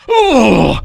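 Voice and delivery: a high, loud gasp of an oh; retold shocked oh. Rod hearing the ten kilo, a high loud shocked oh. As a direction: act out Rod's stunned reaction, high and loud. Rod's reaction on the phone.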